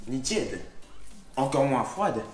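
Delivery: normal talking voice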